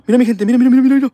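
high, loud, repeated urging; urgent excitement. Real, not acted. Streamer urging viewers to see the entity, frantic excitement. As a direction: push every word up, frantic to make them look.

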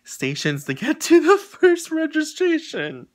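high, slow, whining voice; stretched high-pitched whine; whiny complaint. Retold or imitated. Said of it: "I'm like".

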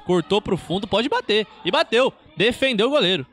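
rising, shouting commentary; excited play-by-play. Real, not acted. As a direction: build to a shout on the shot. Live shot on goal.

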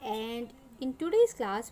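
ordinary talk

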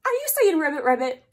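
loud playful mock surprise. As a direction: pretend to be shocked. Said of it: "That's not what the duck says".

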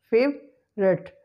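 slow "favorite" in two parts, FAVE, rut; schwa after V deleted. Shown as a demonstration. Teacher drilling the hack, "favorite" slowly split as FAVE-rut. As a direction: split "favorite" with no middle vowel. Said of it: "Schwa sound after V is deleted".

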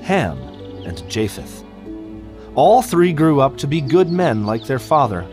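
usual speaking voice

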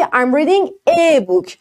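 I'm reading a book, slow, reading stressed; slow model sentence. Shown as a demonstration. Teacher slowly models the sentence for students. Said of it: "بعد بگی".

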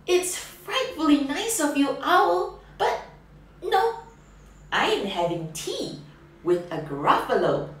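high mouse voice leaping on no; chirpy little refusal; polite mouse. Acted. Mouse declining the owl's tea, chirpy and high.

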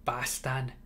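ordinary delivery

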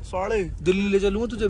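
usual speaking voice

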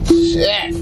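pained high yelp into laughter; brain freeze. Real, not acted. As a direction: wince from the sudden cold. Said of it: "[name] is getting his karma".

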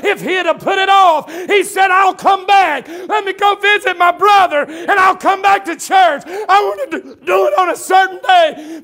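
high, driving, shouted sermon delivery; full-force preaching. Real, not acted. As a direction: preach it hard, punching phrase after phrase.

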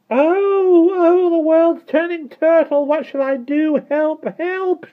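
very high, loud wail; shrieking. A narrator's reading. Miss Tartlett tumbling over in the air, shrieking. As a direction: shriek for help in panic. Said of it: "she shrieked".

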